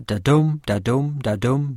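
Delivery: "da-dum" beaten out, light "da", stressed "dum"; iambic rhythm. Shown as a demonstration. Lecturer demonstrating iambic pentameter, "da-dum" with stress on "dum". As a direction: chant "da-dum" evenly, leaning on each "dum". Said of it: "a stressed syllable follows an unstressed one".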